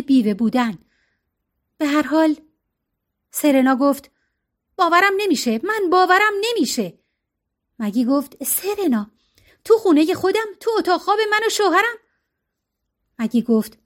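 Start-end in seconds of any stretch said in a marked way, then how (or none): 4.8-7.0 higher, shocked disbelief with falling ends; stunned disbelief. A narrator's reading. Serena finds them together. 8.4-12.0 higher pitched, rushing protest, fast; quick defensive plea to her friend; flustered protest. A narrator's reading. Maggie protests to Serena.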